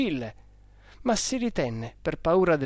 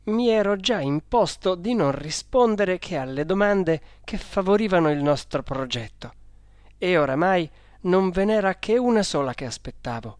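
ordinary delivery, nothing marked

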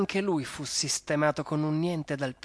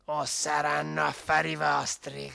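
little dog threatening. Acted. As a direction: warn them off with a tiny dog's bite.

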